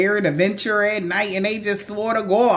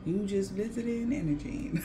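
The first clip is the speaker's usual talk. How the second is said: soft and quiet; 11 dB quieter than usual. Real, not acted. Soft closing reflection.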